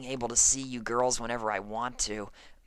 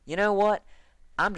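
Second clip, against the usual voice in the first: higher, louder, exasperated; fed-up outburst; 6 semitones higher, 5 dB louder than usual. Acted. Farmer done with Dash, loud exasperated snap. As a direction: snap it before leaving. Applejack storming off.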